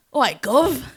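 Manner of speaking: high-pitched attempt at Cockney; Cockney accent. Shown as a demonstration. Asked to show what it sounds like, Cockney accent. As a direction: put on a token East London Cockney. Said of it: "can anyone do a Cockney accent?".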